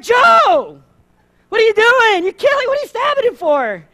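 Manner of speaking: high, loud shocked outburst; shocked outcry. Retold or imitated. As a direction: cry out in alarm at Joe. Everyone shocked at the table.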